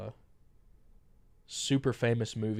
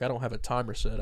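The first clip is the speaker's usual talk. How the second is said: ordinary talk, nothing marked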